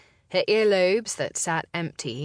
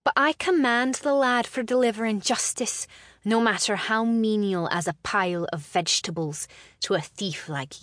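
higher, defiant Scottish lass; forced confidence in a Scottish lilt; Scottish accent; 4 semitones higher than usual. Acted. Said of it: "her throat scratchy with emotion".

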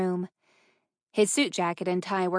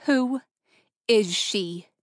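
daring challenge; 3 dB louder, slower than usual. A narrator's reading. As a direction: dare him to lie, word by word. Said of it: "daring him to lie to her".

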